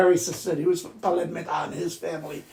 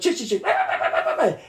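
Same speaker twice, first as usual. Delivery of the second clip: drawn-out yapping mimicry, higher; bird imitating dog; 4 semitones higher, much slower than usual. Mimicking the bird that imitated little Chichi, yappy. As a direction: yap it like a mynah copying a chihuahua. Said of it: "and imitate the dog".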